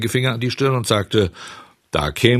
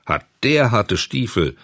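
usual speaking voice

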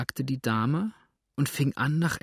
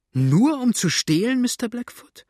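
high, louder outraged rise on Nur; outraged cry; 12 semitones higher, 5 dB louder than usual. A narrator's reading. Widow outraged at Blackfoot's "only to steal", crying out high. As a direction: fling the question back in shock.

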